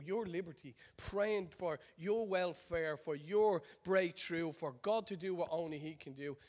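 earnest preaching. Acted. Preacher's quiet appeal.

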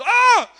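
loud high yelp of shock; startled cry. Retold or imitated. Man in the wheelchair crying out as he is hit. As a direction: jump back with a sharp cry. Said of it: "he jumps back like this and he goes".

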